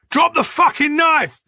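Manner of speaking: loud, high, furious command; explosive police shout; barked order. A narrator's reading. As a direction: bark it at an armed suspect. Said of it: "barked Boyd".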